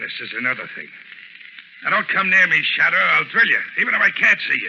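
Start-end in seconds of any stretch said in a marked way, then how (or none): 1.8-4.7 higher, much louder, threatening shout; desperate threat. Acted. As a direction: scream a threat to scare him off. Crook threatens invisible Shadow.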